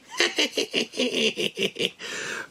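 high breathy puppet giggle; bat giggle. Pretend. Bat puppet laughs at its own joke in a high giggle. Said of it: "The Wuhan bat".

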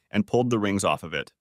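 ordinary delivery, nothing marked

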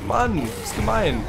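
high, drawn-out whine; long, pouting moan; whiny sulk. Real, not acted. Sulking at unfair creature.

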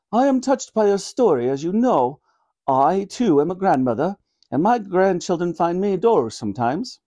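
regal voice, confiding; a monarch admitting she's a grandmother too; sympathetic Queen. Acted. Queen sympathising with Granny, confiding in regal tones.

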